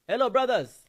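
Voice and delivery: high, loud, bright hello; mock loud greeting. Friend calls over the men across the room, loud and high. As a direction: call out to them loudly.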